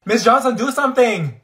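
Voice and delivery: loud, high plea for help; urgent call for teacher. Acted. Student shouting to Miss Johnson across class, loud and urgent. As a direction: yell for the teacher to step in.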